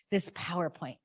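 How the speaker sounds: ordinary talk, nothing marked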